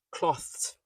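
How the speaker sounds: "cloths" with th and s run together; said fast. Shown as a demonstration. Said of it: "When you say it fast".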